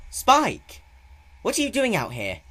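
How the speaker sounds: high, loud call across the street; Twilight's worried call. A narrator's reading. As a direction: call out to find him in the dark. Twilight calls out to Spike.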